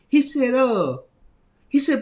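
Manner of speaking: ordinary talk, nothing marked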